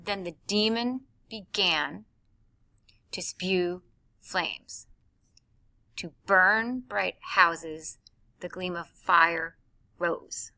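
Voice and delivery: slow, with every beat punched; forced beats. Shown as a demonstration. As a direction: hammer each stressed syllable, slowly. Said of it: "Now I'm forcing it".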